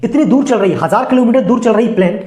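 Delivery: normal talking voice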